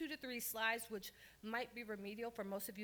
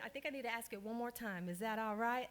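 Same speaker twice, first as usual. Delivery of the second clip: normal talking voice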